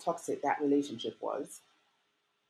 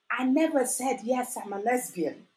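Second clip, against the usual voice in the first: higher and louder, insisting hard; exasperated protest; 9 semitones higher, 5 dB louder, slower than usual. Retold or imitated. Daughter protesting to upset parents she never said anything. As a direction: push back with rising exasperation.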